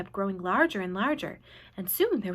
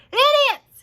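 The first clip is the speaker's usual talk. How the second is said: much higher, loud jeering yell; yelled insult; 9 dB louder than usual. A narrator's reading. Centipede yelling insults at the cloud men, high and loud. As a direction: hurl the insult across the sky. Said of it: "he yelled".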